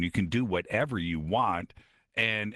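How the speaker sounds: ordinary delivery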